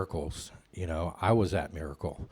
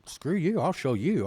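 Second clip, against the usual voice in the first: high, defiant mock snap; defiant retort; 9 semitones higher, 4 dB louder than usual. Newcomer retorts to doubters, high defiant mock snap. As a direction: spit it back in defiance. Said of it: "And I was like".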